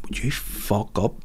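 a mother hissing a quiet threat; hushed parental warning. Retold or imitated. Kate leaning over to scold her son, hushed warning. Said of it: "she just bent over and went".

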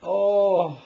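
loud high startled oh; shocked oh. Real, not acted. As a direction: react in real surprise. Pulls gunk from drain.

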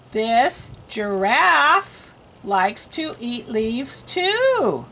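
very slow, word by word, lifting on the last; slow read-aloud. Shown as a demonstration. Models reading for a learner.

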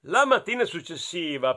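ordinary delivery, nothing marked

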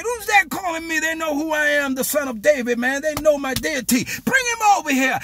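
high-pitched, eager recognition; excited demand. Acted. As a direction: call out high, delighted to be recognized. Jesus reacts to the call.

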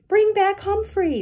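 loud, high, slow crowd shout. A narrator's reading. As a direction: shout it like a room of kids. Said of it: "more voices shouted".

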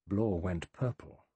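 ordinary delivery

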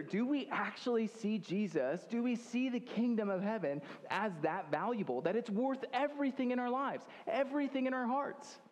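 rising preaching intensity. Acted. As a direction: lift it up and press each question home. Preacher presses the congregation.

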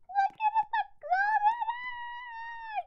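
high, loud excited squeal. Real, not acted. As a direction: squeal it out with glee. Squealing at the golden egg.

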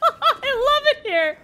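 giddy laughing delight; laughing with joy. Real, not acted.

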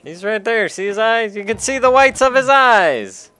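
loud, high, building yell; rising to a full high-pitched yell; exasperated shout. Real, not acted. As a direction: shout it at someone who can't see it. Urgently coaching a player who can't see.

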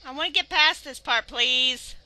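loud, high desperate plea; frustrated pleading. Real, not acted. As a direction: beg the game, exasperated.

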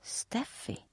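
soft urgent whisper. A narrator's reading. Nelly whispers to Steffi, soft and urgent. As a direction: whisper her name secretly. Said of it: "viskar Nelly".

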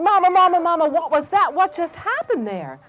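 put-on high, eager little mouse children; excited children. Mouse children question their mother excitedly.

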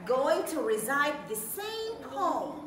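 booming contest announcer; game show host hype. Acted. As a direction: rev up the audience like an emcee. Host hyping the audience.

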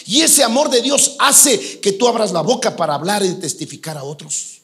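loud, high-pitched sermon at full force; fervent preaching. Acted. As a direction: drive it home to the congregation.

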